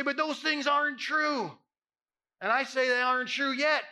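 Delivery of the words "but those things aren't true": loud, slow, doubting objection; mock protest. Doubting churchgoer protesting the pastor's words, loud and slow. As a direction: push back hard on the pastor.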